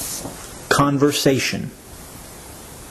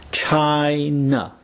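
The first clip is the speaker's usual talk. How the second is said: "China" stretched slowly into "Chi" and "na"; split syllables. Shown as a demonstration. Teacher showing two syllables, "China" said very slowly. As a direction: draw out each syllable of "China". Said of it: "is made up of two syllables".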